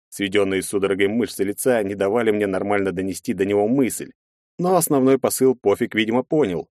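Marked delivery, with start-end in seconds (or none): none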